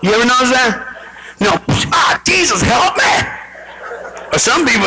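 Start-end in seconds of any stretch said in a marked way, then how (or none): 1.9-3.1 high, acted pain yell; screamed cry for help. Hurt person calling on Jesus, high acted scream. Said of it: "word people scream".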